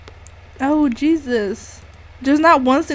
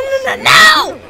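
much higher and louder, shouted no's; real panicked shout; 12 semitones higher, 8 dB louder, slower than usual. Real, not acted. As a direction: yell to stop the dog in a panic. Panic at dog eating food.

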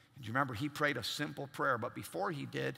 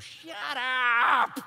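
higher, loud cartoon cat yell; mock cartoon shout; 8 semitones higher, 8 dB louder than usual. Cartoon cat slapping the rooster, loud mock shout. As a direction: snap it like an annoyed cartoon cat. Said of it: "and goes".

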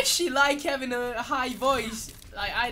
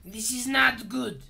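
exclaiming mock-Frenchman; French-accented dismay; put-on French accent; 3 semitones higher, 9 dB louder, slower than usual. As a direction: react to the match in a French accent. Match reaction in put-on accent.

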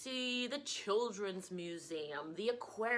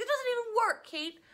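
much higher, louder exasperated cry; 13 semitones higher, 6 dB louder than usual. Retold or imitated. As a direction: squeal it in disbelief at the app. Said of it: "I was like".

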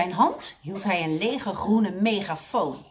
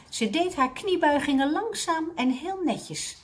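plain reading voice

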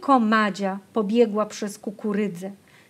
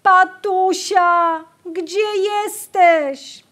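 high, slow, pitiful call; plaintive call; 9 semitones higher, 6 dB louder, much slower than usual. A narrator's reading. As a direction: call out weakly for the sister, lost. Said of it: "żałosne wołanie".

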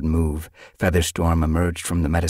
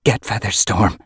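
raised, urgent command; curt order; 4 semitones higher, 4 dB louder than usual. A narrator's reading. Yellowfang cutting off a question, urgent order. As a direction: order it without explaining. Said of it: "she ordered".

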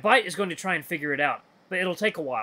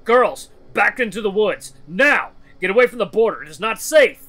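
loud, raised, urgent commands; shouted orders; 5 semitones higher, 9 dB louder than usual. Acted. Loken driving children from the border, urgent shouted orders. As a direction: order them back with full force.

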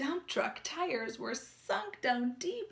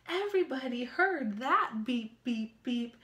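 ordinary delivery

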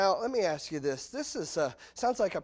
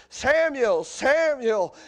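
loud, higher calling of a name; 8 semitones higher, 10 dB louder, much slower than usual. Retold or imitated. The Lord calling the boy Samuel, loud call. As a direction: call his name across the night. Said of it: "the Lord says".

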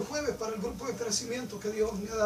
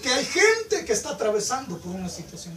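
louder preacher's push on the whole phrase; preaching at full force; 5 semitones higher, 10 dB louder than usual. Acted. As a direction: drive it home like a preacher. Preacher surges mid-sermon.

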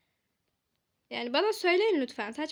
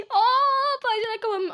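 surprised; 8 semitones higher, 7 dB louder than usual. Real, not acted. Shutters close.

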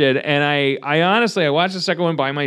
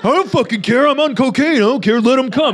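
higher, louder brat voice; cocky son; 9 semitones higher, 4 dB louder than usual. Retold or imitated. Son brushes off father's warning, high cocky brat. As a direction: brat bragging, laughing it off.

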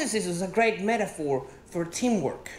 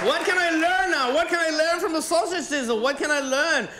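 exasperated rant; 6 semitones higher than usual. Acted.

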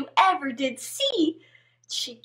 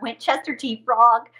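plain reading voice

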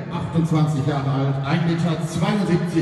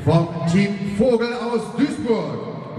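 boxing ring announcer; 10 semitones higher than usual. Acted. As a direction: stretch the names like a ring announcer. Ring introduction of fighters. (Ringsprecher, der die Namen langzieht)

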